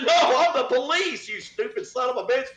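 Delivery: high, laughing put-down; laughing retort. Retold or imitated. Cop laughing at the suspect's mix-up, high laughing retort. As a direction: laugh it out in disbelief. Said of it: "And I said".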